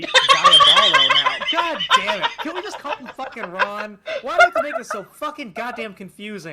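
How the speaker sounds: real laughing exasperation; exasperated. Real, not acted. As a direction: rant at the stupid name. Exasperated at silly name.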